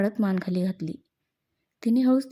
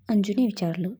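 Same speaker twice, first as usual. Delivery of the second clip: plain reading voice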